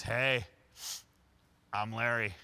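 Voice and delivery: loud, bold hello to a stranger; cheerful introduction. Retold or imitated. Larry greets the speaker's date, loud and bold. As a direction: lean in and announce yourself.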